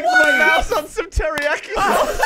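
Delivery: high, loud yell through laughter; real hysterical shout; laughing panic. Real, not acted. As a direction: blurt the excuse out, cracking up. Frantic excuse after accidental killing.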